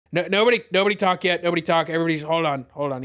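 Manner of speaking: flustered first mate. Acted. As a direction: scramble to stop everyone talking. Gallium flustered stops prisoners.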